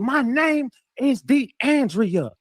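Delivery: very high, slow imitated yell; drawn-out high mock scream of her name. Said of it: "She yelled it".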